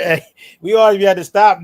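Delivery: usual speaking voice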